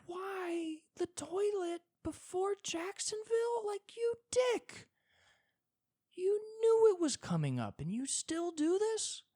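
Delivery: very high, slow, strained squeal at God; whiny outraged falsetto. Pretend. Complaining to God about the toilet, a high outraged whine. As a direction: squeal up at the sky like a betrayed spouse. Railing at God before Jacksonville.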